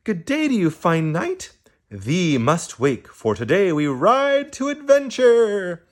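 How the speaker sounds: high, hammed-up storybook knight greeting; mock knight speech. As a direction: proclaim it like a pretend knight off on a quest. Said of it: "owls don't talk like that".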